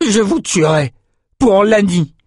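high, loud enraged shout; furious vow. A narrator's reading. Ren vows to kill Rouva for Lani, loud high rage. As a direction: scream the vow of revenge.

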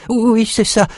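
hurried forced agreement; nervous servant. A narrator's reading. Cook covering his lie, high and eager. Frightened slave lies.

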